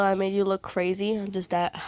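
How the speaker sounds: ordinary talk, nothing marked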